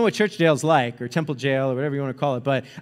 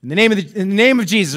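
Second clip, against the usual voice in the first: ordinary talk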